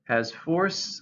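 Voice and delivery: ordinary talk, nothing marked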